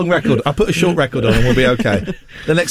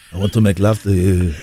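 lower, hammed-up Italian seducer; a mock Italian romeo, laying it on; Italian accent; 7 semitones lower than usual. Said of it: "have you given yourself a voice?".

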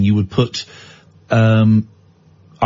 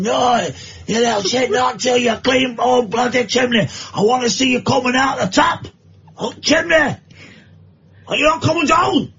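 mother shouting; 8 semitones higher than usual. Acted. Mam shouting at her child up the chimney, hammed-up yell. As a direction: bellow at the kid like a furious mam.

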